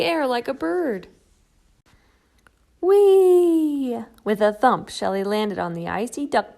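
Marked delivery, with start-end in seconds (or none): 2.8-4.0 high, loud thrilled cry; joyful whee. Acted. Shelley flying off the bump, loud gleeful whee. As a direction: squeal with delight. Sliding down the hill.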